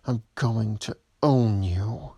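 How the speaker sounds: ordinary talk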